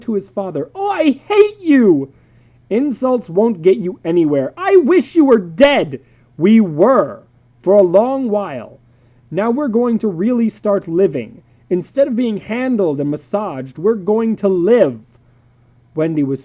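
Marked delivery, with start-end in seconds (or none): 0.7-2.1 put-on slow, high, loud childish scream; furious shout. Son shouts at father. 2.7-6.0 angry father. Acted. 6.4-15.0 loud declaration. Acted. Father declaring.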